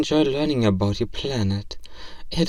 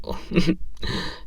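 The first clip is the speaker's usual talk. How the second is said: a short breathy nervous laugh; shy little giggle; 5 semitones higher than usual. Acted. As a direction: laugh off the awkward moment. Nervous laugh in meet cute.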